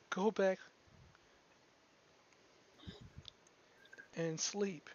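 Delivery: put-on high, pleading girl's voice with a touch of tears; crying young girl. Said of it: "she starts to cry a good bit".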